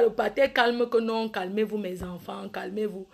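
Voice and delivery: low, soft soothing father; calming father. Acted. Father on the phone soothing quarrelling children, soft and low. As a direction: settle the kids down gently.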